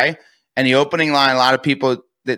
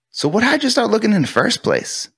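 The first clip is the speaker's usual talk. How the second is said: high, lifted curious question; curious tonality; 8 semitones higher than usual. Shown as a demonstration. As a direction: ask it lightly, just wondering. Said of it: "My tonality is a curiosity tonality".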